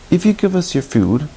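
lightly voiced high-pitched fox; a hint of a wheedling fox; fox character bargaining.

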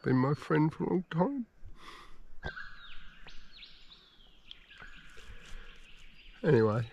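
quiet, soft, choked-up musing; holding back tears while speaking; emotional. Real, not acted. Alone in the bush, telling viewers about his dying dog, softly emotional. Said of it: "I'm feeling a little bit emotional".

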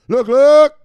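loud, high, punchy catchphrase yell; hype shout. Pretend. Streamer hyping his viewers with a loud high shout. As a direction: bark it out like a hype man.

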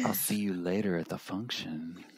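softly quoting the catchphrase; quiet mock quote. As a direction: say it low and soft, quoting. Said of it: "quoting the T-shirt phrase".

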